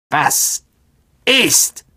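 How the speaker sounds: each word a separate high burst; clicking old spider. A narrator's reading. The blind old spider answering a call, clicking creature voice. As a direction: snap out the words one by one, spider-like. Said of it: "klickte schnell mit ihren Greifern".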